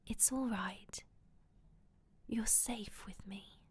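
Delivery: very soft, breath-led reassurance; soothing inner voice. A narrator's reading. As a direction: soothe him in a slow near-whisper. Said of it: "Melissa's voice was in his mind".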